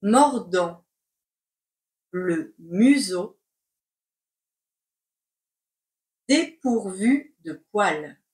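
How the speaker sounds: slow, chunked with long pauses; slow dictation. Shown as a demonstration. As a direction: dictate word by word. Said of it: "C'est parti pour la dictée".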